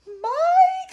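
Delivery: very high, loud scream. A narrator's reading. Mother screaming after her running son. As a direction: scream his name. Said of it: "screamed Missus T V".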